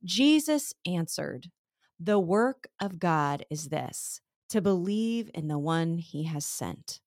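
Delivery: slow, deliberate recitation of the verse; slow memory verse. Shown as a demonstration. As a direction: read it out slowly, word by word for memorizing. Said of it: "and it says this".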